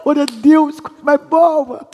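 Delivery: a playful, hammed-up wife's pleasured exclamation; wife in pleasure. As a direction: moan the praise like a wife in bliss. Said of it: "fala, aleluia".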